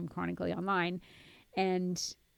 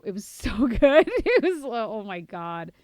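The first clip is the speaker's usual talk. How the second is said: laughing through the words; 4 semitones higher, 11 dB louder than usual. Real, not acted. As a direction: giggle as you say it. Laughing at a funny video.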